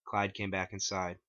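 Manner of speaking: plain reading voice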